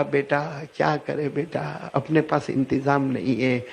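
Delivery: a helpless mother crying to her son; weeping mother. A narrator's reading. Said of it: "اب ماں بھی روئی". (دھیمی روتی ہوئی آواز)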